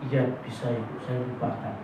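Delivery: timid soft yes to the pastor; meek mumbled reply. Retold or imitated. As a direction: answer like a cowed churchgoer. Meek man gives in to pastor.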